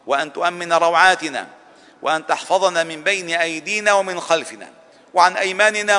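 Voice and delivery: high, loud preaching supplication; impassioned dua. Acted. Imam leading the congregation's closing prayer, full-force fervent supplication. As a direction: pour the whole heart into each plea.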